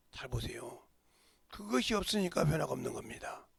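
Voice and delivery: ordinary delivery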